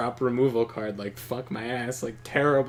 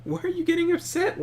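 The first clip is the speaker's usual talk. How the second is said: high-pitched retold disbelief; exasperated questioning. Retelling to friend a card-game argument, exasperated. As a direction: re-enact baffled questioning of someone upset. Retells arguing with upset opponent.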